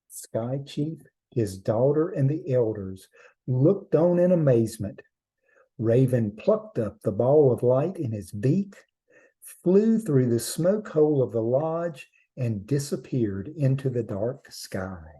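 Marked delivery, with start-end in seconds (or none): none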